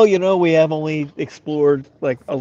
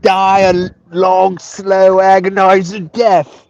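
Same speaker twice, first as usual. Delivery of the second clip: slow, higher mock Australian whale voice, louder as it builds; mock Australian accent; 4 semitones higher, 4 dB louder, slower than usual. A whale weighing its two awful choices, in mock Australian. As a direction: ham up a rough Australian accent, dragging out the grim options. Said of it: "It's not as Australian as I would like".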